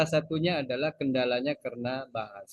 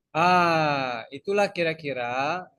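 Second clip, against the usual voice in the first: ordinary talk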